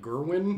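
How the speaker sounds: "Gerwin" for Gawain, GUR-win; "Gerwin" as King Arthur's version of Gawain; favorite odd pronunciation. Shown as a demonstration. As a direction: say "Gerwin" with an er first vowel. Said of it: "King Arthur gives my favorite pronoun".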